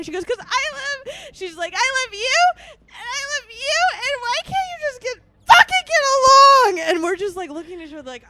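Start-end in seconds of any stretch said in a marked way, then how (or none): 0.2-1.1 high-pitched imitated tearful whine; mock crying. Drunk friend crying to the two, imitated sobbing. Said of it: "She's crying". 1.8-6.9 high imitated wail building to a loud shout; mock crying. Drunk friend begging both to get along, hammed-up crying. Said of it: "She's crying".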